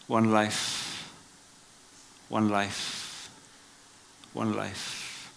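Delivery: usual speaking voice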